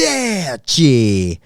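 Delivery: slow, drawn-out Italian goodbye; mock Italian. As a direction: say it like a proud fake Italian. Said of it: "which I know is something in Italian".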